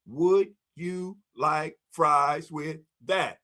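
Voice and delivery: slow, deliberate cashier upsell; drawn-out upsell line. Retold or imitated. Cashier to customer, slow upsell pitch. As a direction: stretch every word like a cashier. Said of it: "They say".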